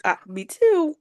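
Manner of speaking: usual speaking voice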